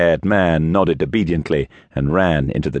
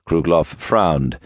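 plain reading voice

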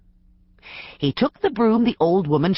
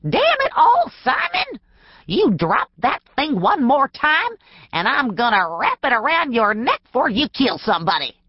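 put-on much higher, sharp old woman scolding; old woman clerk scolding the storekeeper, much higher; crotchety clerk; 7 semitones higher than usual. A narrator's reading. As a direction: bark at him like a cranky old clerk. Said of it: "the voice of a crotchety woman clerk".

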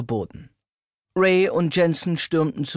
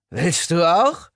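slurred; 2 semitones higher, 3 dB louder, slower than usual. A narrator's reading. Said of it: "lallte ich".

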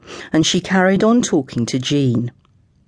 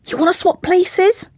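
tetchy mum. A narrator's reading. Mum snaps back at her child.

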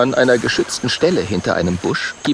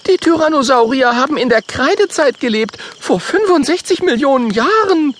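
higher, louder objecting voice; indignant; 4 dB louder than usual. Acted. Mathilda protests to Kokosnuss, higher indignant voice. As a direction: protest with outrage. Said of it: "ruft Mathilda empört".

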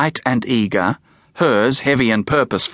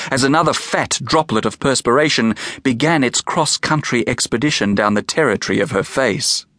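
ordinary delivery, nothing marked

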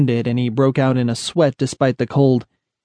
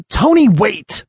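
urgent, higher-pitched call; 8 semitones higher, 4 dB louder, slower than usual. A narrator's reading. Loomis calls to a companion heading into the tunnel, calling out. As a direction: call after him to stop. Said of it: "Loomis called out".

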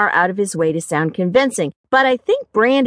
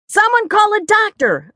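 high, urgent call for help; urgent yell; 12 semitones higher than usual. A narrator's reading. Narrator calling for help after Brandy faints, yelling. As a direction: yell out to anyone nearby. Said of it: "I yelled".

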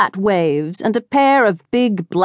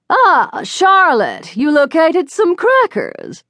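haughty society matron; 11 semitones higher, slower than usual. A narrator's reading. Babs greets the girls loudly.

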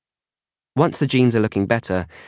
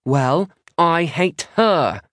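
high, louder retort, drawn out; countering; 9 semitones higher, 4 dB louder, much slower than usual. A narrator's reading. Maisie answering Isla's insult, countering. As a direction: throw it back higher and harder. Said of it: "Maisie counters".

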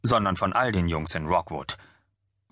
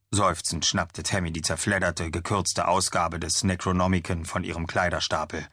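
usual speaking voice

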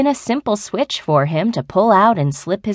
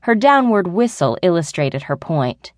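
plain reading voice

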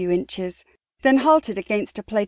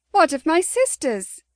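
demanding; 7 semitones higher than usual. A narrator's reading. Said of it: "Chastity demanded".

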